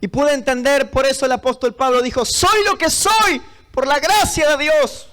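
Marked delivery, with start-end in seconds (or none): 2.2-5.1 higher, drawn-out proclamation; preacher declaiming scripture; emphatic declaration. Retold or imitated. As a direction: proclaim it slowly and fervently. Declaiming Paul's words to congregation.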